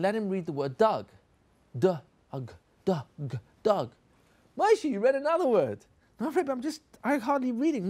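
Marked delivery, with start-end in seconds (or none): 4.6-5.8 high, bright teacher praise; excited praise. Acted. Rebbe praises pupil. 6.2-7.9 put-on higher, timid little boy protesting; a shy pupil brushing off the praise; child's voice. Pupil answers his rebbe.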